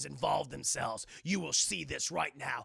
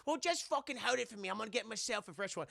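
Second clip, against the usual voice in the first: mock British girl; 3 semitones higher, faster than usual. Girl answering Janet.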